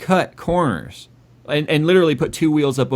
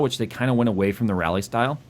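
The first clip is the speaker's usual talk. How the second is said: normal talking voice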